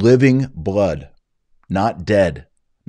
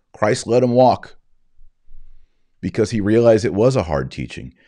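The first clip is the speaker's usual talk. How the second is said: ordinary delivery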